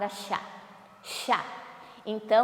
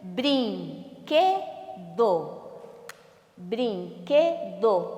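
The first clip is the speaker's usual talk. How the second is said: BRINQUEDO split slowly into brin, que, do; syllable by syllable; 4 semitones lower, much slower than usual. Shown as a demonstration. Teacher has pupils count syllables, "brinquedo" syllable by syllable. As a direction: say "brinquedo" in three slow, separate beats. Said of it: "Quantas sílabas?".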